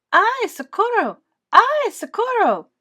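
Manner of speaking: high, loud, slow panicked yell; panicked cry for help. Acted. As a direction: scream for help while fleeing. Farmer chased by snake.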